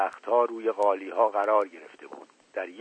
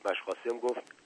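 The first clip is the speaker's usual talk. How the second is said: ordinary delivery, nothing marked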